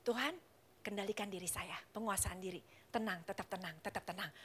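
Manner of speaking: praying alone in the car to stay calm; quiet self-calming prayer. Retold or imitated. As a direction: talk yourself down gently. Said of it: "berdoa sama Tuhan".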